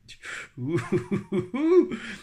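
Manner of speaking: long hooting laugh, rising high. Real, not acted. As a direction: dissolve into a drawn-out laugh. Breaks down over the message. (long fou rire)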